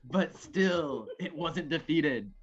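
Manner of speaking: dramatic bardic tale-telling; storytelling narration. Acted. Reading the play's opening fight to friends, dramatic narration. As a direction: narrate an epic battle tale, weighing each phrase.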